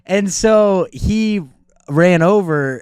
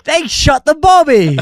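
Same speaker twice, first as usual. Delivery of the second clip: playful put-on British accent, a bit louder; mock British accent; 11 semitones higher, 6 dB louder than usual. Joking to a friend about a Brit, mock British accent. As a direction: ham up the British accent for a laugh. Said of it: "Why are you using this accent?".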